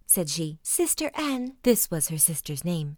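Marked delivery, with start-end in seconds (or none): 0.6-1.6 higher urgent plea; pleading wife. A narrator's reading. Wife urgently begging her sister, higher voice. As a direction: beg your sister, pitched up.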